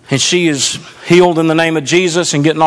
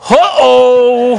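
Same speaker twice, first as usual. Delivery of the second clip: drawn-out, slightly raised uh-oh; comic dread; 5 semitones higher, 4 dB louder than usual. Retold or imitated. Pastor realizes they may get kicked out, mock alarm. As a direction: sense trouble coming and play it for laughs.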